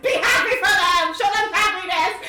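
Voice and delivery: high, loud, laughing command; joyful shout. Acted. Anna at the door telling everyone, joyful shout.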